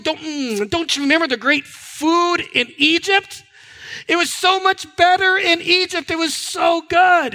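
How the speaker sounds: high-pitched, savouring reminiscence; nostalgic longing. Acted. Israelites remembering the melons of Egypt, longingly. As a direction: play up the longing for lost food. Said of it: "They start talking about the melons of Egypt".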